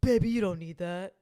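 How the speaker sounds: lightly put-on boyfriend voice; Travis impression. Imagining the boyfriend talking the singer out of filler, lightly put-on voice. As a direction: coo it like an indulgent boyfriend. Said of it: "once you said it in that voice".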